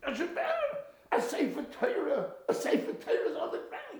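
very high, loud, horrified exclamation; scandalised outcry. Acted. Rabbi finds the great scholar on the kitchen floor, scandalised shouting. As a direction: cry out as if witnessing a sacrilege.

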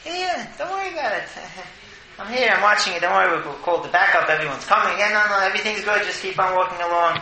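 high-pitched, hurried crowd control; reassuring fake policeman. Acts fake cop reassuring the crowd.

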